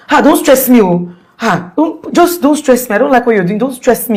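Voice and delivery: hammed-up husband snapping at his burnt wife; mock angry husband. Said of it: "he said, pushing her head".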